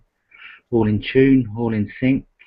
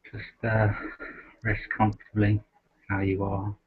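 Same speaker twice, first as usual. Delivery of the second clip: soft, unhurried meditation voice with long pauses; guided meditation; 2 semitones lower, 6 dB quieter than usual. Acted. Host guiding the group to rest in awareness, softly meditative. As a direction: gently settle listeners into stillness, leaving space between phrases.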